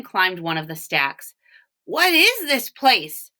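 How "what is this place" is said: called out. Acted. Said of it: "Walter called out".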